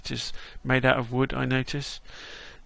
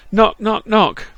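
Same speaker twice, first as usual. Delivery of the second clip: loud spoken knock on the door; standing in for the door sound, loud knocks; 7 semitones higher, 9 dB louder, slower than usual. Shown as a demonstration. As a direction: call out the knocks as the sound effect. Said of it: "Let's do it manually".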